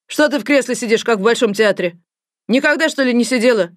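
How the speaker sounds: loud, sneering scold; mock the frightened girl loudly; a scary doctor mocking a shy girl in the chair.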